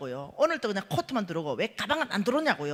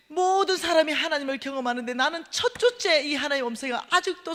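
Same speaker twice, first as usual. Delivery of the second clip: higher, loud hammed-up lament; dramatic letter reading; 5 semitones higher, 7 dB louder than usual. Reading the gift letter aloud, hammed-up exasperation. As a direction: ham up the letter's complaint. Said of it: "편지를 내가 딱 읽으니까".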